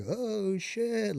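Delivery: high, sudden scared gasp of words; startled fear. Retold or imitated. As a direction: blurt it out in shock. Scared reaction to firefight.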